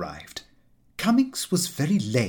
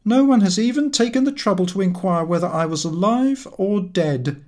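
higher, louder put-on voice of a sulky sick friend; aggrieved invalid; 8 semitones higher, 4 dB louder than usual. A narrator's reading. Cummings complaining to his neglectful friend, aggrieved and loud. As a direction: whine out the grievance, raised and loud.

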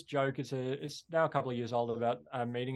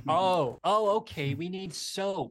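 higher, louder mock American accent; American impression; 6 semitones higher, 4 dB louder, slower than usual. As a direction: imitate the girlfriend's broad American accent. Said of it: "You have a good American impression".

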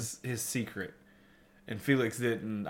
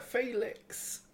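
breathy whisper; 10 semitones higher, 14 dB quieter than usual. Retold or imitated. Creepy whisper of a name.

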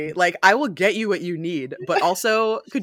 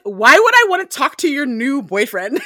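higher, louder jealous whine; mock jealous Lestat; 8 semitones higher, 8 dB louder than usual. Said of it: "Lestat's like".

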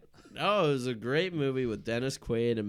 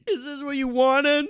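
high, loud wounded protest; mock outburst; 10 dB louder than usual. Host caught faking a favorite movie, hammed-up wounded outburst. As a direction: cry out as if publicly shamed.

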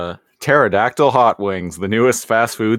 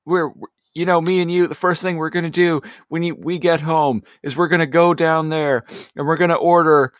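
hopeful soldier comforting friend; 6 semitones higher than usual. Pretend. Soldier keeps comforting buddy.

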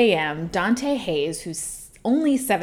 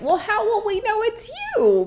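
put-on high old lady voice; 14 semitones higher than usual. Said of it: "the woman was like".

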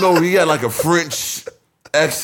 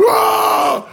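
breathy shrieking yelp, much higher; shrill scream. Retold or imitated. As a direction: let out a high shriek. Retold scream.

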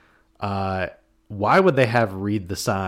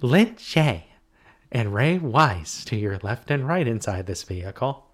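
flight attendant announcing to passengers; polite cabin announcement voice; stewardess voice; 4 semitones higher than usual. Pretend. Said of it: "The stewardess voice is coming out again".

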